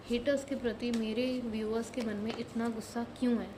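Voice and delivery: quiet, prayer-like chant to the spirit guides; soft invocation. Acted. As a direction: murmur the question over and over while shuffling. Shuffling cards, invoking guides. (धीमी, प्रार्थना जैसी पुकार)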